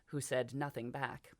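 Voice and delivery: ordinary delivery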